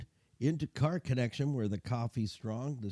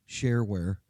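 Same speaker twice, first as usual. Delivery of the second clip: ordinary talk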